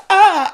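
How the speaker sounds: short, loud, high yelp; mock pained yelp. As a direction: yelp as if the match burnt your fingers. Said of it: "it burns down to her fingers".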